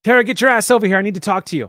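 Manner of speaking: loud high shout. Acted. Leader summoning Tara, loud shout. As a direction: bark the order across camp. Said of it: "She gives a call out".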